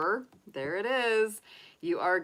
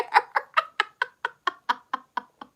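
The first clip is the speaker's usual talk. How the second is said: high, falling laugh; 13 semitones higher, 8 dB louder than usual. Real, not acted. Laughing at campers joke.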